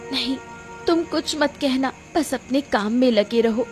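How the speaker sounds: steady your husband with wounded resolve; hurt, resolute wife. Acted. Narrator voices the wronged wife. (आहत पर दृढ़ पत्नी की आवाज़)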